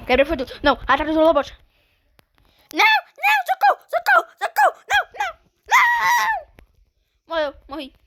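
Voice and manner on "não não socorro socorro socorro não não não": high, loud panicked cries for help; panicked screaming. Real, not acted. Gamer swarmed by robots, screaming high for help. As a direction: shriek for help as you get swarmed.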